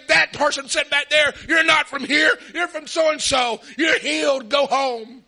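loud, fired-up calling out of a stranger; shouting out a healing. Retold or imitated. Healer shouting across the church at a stranger, loud and fired up. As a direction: call him out and send him home healed.

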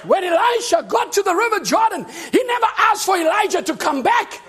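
loud, high, shouting preacher; preaching. Acted. Preacher shouting to the church about the God of Elijah. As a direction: preach it hard to the congregation.

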